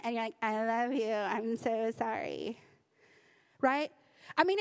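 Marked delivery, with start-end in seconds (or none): 0.4-2.6 drawn-out, low mock apology; forced sincerity. Child told by parent to mean it, exaggerated forced apology. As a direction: drag it out as if pretending to mean it. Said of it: "Say it like you mean it".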